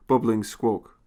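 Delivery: ordinary delivery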